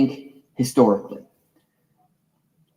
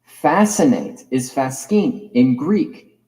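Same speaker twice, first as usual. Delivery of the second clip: ordinary talk, nothing marked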